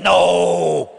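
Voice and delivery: loud blunt shout; emphatic no. Retold or imitated. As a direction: bark it out bluntly as Henry. Henry answers "She rich?.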